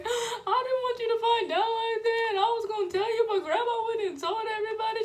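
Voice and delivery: high, loud laughing sob; crying. Retold or imitated. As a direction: plead through sobs and laughter. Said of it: "So I started crying like".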